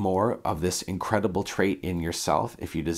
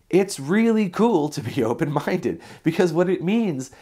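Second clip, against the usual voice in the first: excited; 7 semitones higher, slower than usual. Real, not acted. Raving about open-mindedness.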